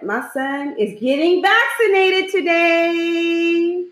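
high, drawn-out excited news; excited announcement. Real, not acted. Mother announces her son's vaccination, high excited drawl. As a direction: announce it high and stretch the words out. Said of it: "I'm so excited".

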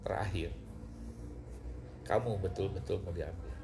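lightly voiced voice from above, pitched low; a hint of solemn, low authority; booming divine voice. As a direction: put the final question with quiet weight. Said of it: "gelegar gede".

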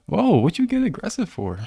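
high-pitched, playful protest; mock affront. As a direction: act taken aback, pitch jumping way up. Play affront after friend's insult.

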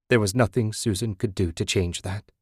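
ordinary delivery, nothing marked

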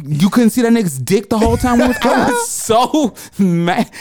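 normal talking voice

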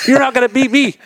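high, put-on taunt; taunting teammate. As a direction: tease him in a high mock voice. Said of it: "It's like".

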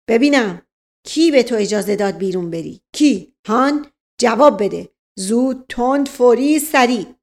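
loud, rapid-fire interrogator; bully him with questions; barking police officer. Acted. Officer grilling the old servant, harsh and loud.

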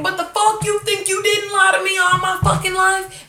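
high, loud mock shout; snapping back, hammed-up. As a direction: yell an outraged comeback at mom. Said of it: "then she starts snapping".

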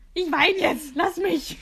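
loud, high retort through tears; mock crying shout. Crying friend snaps back at a bad comforter, loud and high. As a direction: snap back loudly while crying. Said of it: "Und die Person so".

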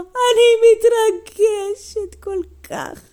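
high, loud, drawn-out wail; overcome grandma. Acted. Grandma overcome at getting her box back, hammed-up wail. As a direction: wail it slowly in mock emotion.

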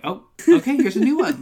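high, loud hammed-up enthusiasm; mock excited. Mocking the show's new song, hammed-up excitement. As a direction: play the eager host of a new song, overdone. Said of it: "it's always just like".

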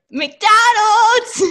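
excited shout. Retold or imitated. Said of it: "They'd be like".

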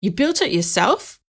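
mildly incredulous gruff man; a touch of disbelief, rising; sharply rising. A narrator's reading. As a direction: question it with a sharp lift. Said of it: "Jim's voice rose sharply".

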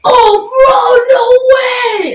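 loud, high bro reaction; frat bro. Retold or imitated. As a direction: gush like a hyped reaction YouTuber. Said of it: "they just go".

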